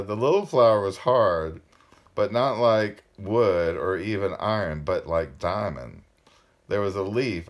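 emphatic, projected read-aloud; story narration. A narrator's reading. Reader telling of the diamond-hard flower, emphatic storytelling. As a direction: narrate with weight on the key words.

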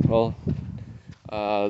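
ordinary talk, nothing marked

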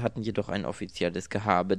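plain reading voice

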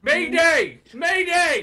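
high, loud emergency call; distress shout. Acted. Stranded person calling the party for help, distress shout. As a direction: holler for help across a distance.

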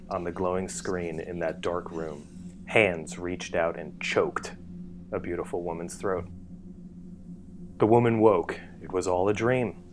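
usual speaking voice

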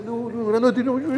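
high wailing voice, laughing through it; mock crying plea. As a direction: play the big man whimpering for his mother. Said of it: "lloraba, estaba llorando". (imitación burlona de un llanto agudo)

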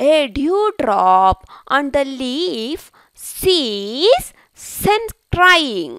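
slow, drawn-out storybook reading; slow read-aloud to children. Acted. Teacher reads the ant story to pupils, slow and deliberate. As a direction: read it very slowly for young learners.

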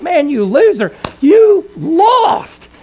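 high, loud jeer; mocking taunt. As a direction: rub it in like teasing friends, pitch leaping up. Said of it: "everyone's gonna rib you and tease you".

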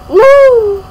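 loud, high drawn-out "no"; mock cry of despair. Mock wail of despair at being poor.

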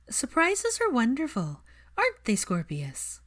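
slow, airy and lilting, starting high; dreamy Luna. A narrator's reading. Luna dreamily teasing Draco and Hermione, slow and airy. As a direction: float the words like a daydream. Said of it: "Luna's dreamy voice".

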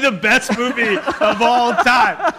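loud, high, slow laughing exclamation; laughing shout. Real, not acted. Cohost cracking up at the casting idea, shouting with laughter. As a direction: howl it out through laughter. Joking about absurd casting.

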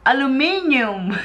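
ALUMINIUM as "a-loo-MIN-yoom"; mother's ALUMINIUM. Retold or imitated. Wife imitates her nanay, ALUMINIUM ending in "yoom". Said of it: "my nanay, say it".

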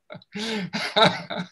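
high free laugh; big belly laugh; demo laugh. Shown as a demonstration. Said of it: "try it, laugh".

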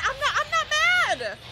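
high, loud laughing denial; laughing not mad. Real, not acted. Streamer insisting she's not mad after being left, high laughing. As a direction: laugh while insisting you're fine. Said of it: "is anybody else mad?".

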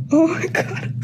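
fighting back tears; near tears. Real, not acted. Reader overwhelmed by the book, near tears. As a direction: voice cracking on the edge of crying. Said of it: "I'm about to cry".

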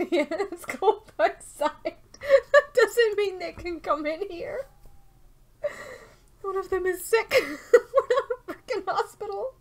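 high, slow words squeezed through laughter; helpless laughing protest. Real, not acted. Streamer complaining about sick dogs coming in, helpless laughter. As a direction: argue back while cracking up.

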